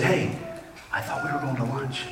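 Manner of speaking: hushed aside from the back seat; imitated whisper. Girlfriend questions him in the back seat, imitated whisper. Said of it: "she whispers to, to me".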